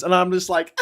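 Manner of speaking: ordinary talk, nothing marked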